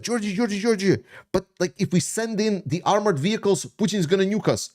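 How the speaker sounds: high mocking voice of a panicky doubter; mocking critics. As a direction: ape the people who always warn him, pitched high. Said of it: "And then it's like".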